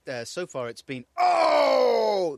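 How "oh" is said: loud, high groaning "Oh!" of a disappointed crowd; crowd cheer. Retold or imitated. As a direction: mimic a whole crowd letting out a cheer. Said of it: "Those have been the main cheers I've heard".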